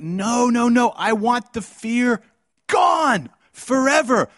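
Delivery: high, slow, loud insistence; shouted command. Retold or imitated. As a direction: drive it home like a divine order. God insists, shouted slowly.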